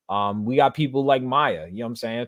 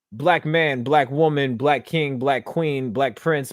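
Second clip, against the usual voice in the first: ordinary talk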